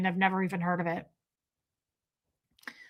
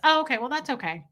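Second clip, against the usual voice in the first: ordinary talk, nothing marked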